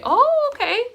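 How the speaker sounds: loud, squeaky-high "oh, okay"; high-pitched acknowledgement. Real, not acted. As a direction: shoot the pitch way up. Repeat, much higher.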